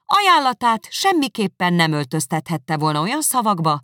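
loud, firm, cutting; cold angry refusal. Acted. Elizabeth refusing Darcy's proposal, loud and cutting. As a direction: refuse him flatly.

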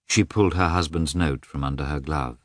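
plain reading voice

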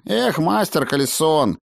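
high, aggrieved little voice; offended halfling. Acted. Halfling who expected the master to rejoice, aggrieved. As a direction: reproach him in a piping, hurt tone.

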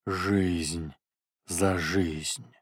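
a portal speaking in a warped bass; distorted bass. Acted. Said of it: "молвил портал искажённым басом".

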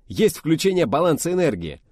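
high, loud military report; drill report. Acted. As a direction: call it out by the book.

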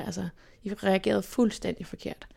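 ordinary delivery

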